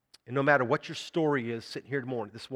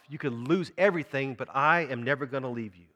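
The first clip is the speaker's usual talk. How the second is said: ordinary talk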